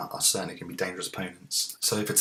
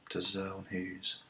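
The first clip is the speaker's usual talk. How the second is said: whisper; 7 dB quieter than usual. Real, not acted. Muttering to himself.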